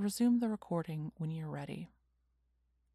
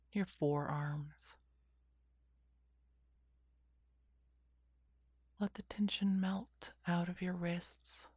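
whispered guided meditation; slow calming whisper, lots of pauses; soft spa whisper; 2 semitones lower, 4 dB quieter than usual. Acted.

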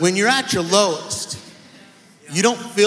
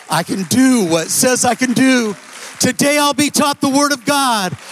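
loud, high preaching declaration; 4 semitones higher, 6 dB louder than usual. Acted. Pastor proclaims his son's declaration to the church, full force. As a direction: proclaim every line to the rafters.

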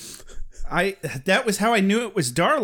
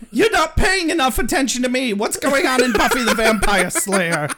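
mom voice; 5 semitones higher, 3 dB louder than usual. Pretend. Mock mother scolding son.

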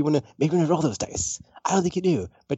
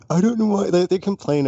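higher-pitched imitation of a complainer; mock complaint; 8 semitones higher, 4 dB louder than usual. Quoting a Twitter commenter, mock complaint. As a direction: mimic a baffled online griper.

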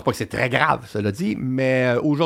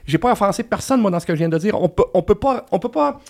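higher-pitched, emphatic protest; indignant rebuttal; 6 semitones higher than usual. Acted. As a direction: push back insistently in a raised pitch. Indignant reply to an imagined offended person.

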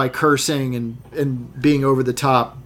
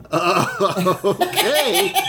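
laughing acceptance; 4 semitones higher, much slower than usual. Retold or imitated. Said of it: "And he's like".

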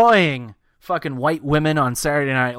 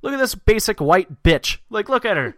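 mock outrage; 7 semitones higher, 4 dB louder than usual. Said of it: "It's like".

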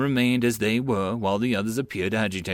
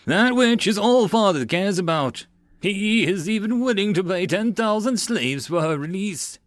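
sneering; 9 semitones higher, 3 dB louder than usual. A narrator's reading. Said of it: "Titus sneered".

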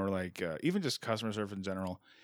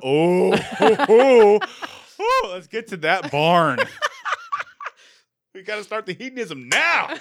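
loud, high whoops breaking into laughter; hammed-up whooping excitement; 10 semitones higher, 13 dB louder than usual. Host urging everyone to get to the barn, rowdy mock excitement. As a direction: holler like a rowdy reveller kicking off a party.

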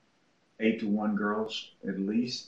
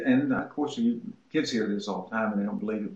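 ordinary talk

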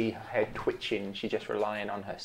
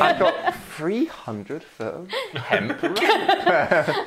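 silly character voice; 13 semitones higher, 8 dB louder, much slower than usual. Acted. GM mock character boast, laughing.

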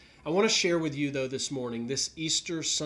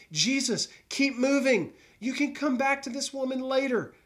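much higher, pressing plea to hurry on; urgent disciples; 10 semitones higher, 3 dB louder than usual. Acted. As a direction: voice the impatient followers pushing Jesus along. Disciples urging Jesus to hurry.

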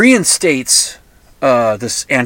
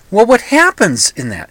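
ordinary talk

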